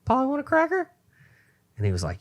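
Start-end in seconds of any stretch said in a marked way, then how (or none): 0.1-0.9 very high, squawky parrot call; shrill hammed-up parrot cry. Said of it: "then it goes again".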